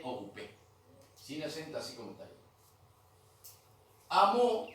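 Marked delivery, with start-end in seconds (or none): 4.1-4.7 Amo called out high and loud; teacher's model word. Shown as a demonstration. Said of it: "es aquel o aquella". (palabra modelo Amo, dicha fuerte y aguda)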